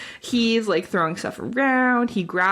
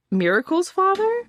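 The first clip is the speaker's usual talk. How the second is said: much higher, incredulous; stunned question; 11 semitones higher than usual. Retold or imitated. Mother confronted by husband, high incredulous question. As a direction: ask it in shocked disbelief.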